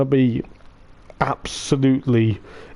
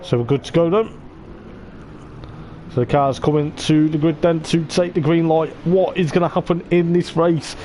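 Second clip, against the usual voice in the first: higher, livelier play-by-play as the race starts; excited race commentary; 4 semitones higher than usual. Acted. Commentator calling the cars onto the grid, higher and excited. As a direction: call the grid forming, building anticipation.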